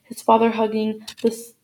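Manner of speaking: usual speaking voice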